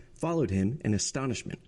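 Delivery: plain reading voice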